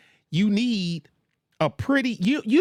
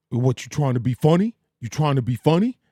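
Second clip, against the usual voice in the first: mock cop challenge; 3 dB louder, faster than usual. Cop replying in the skit.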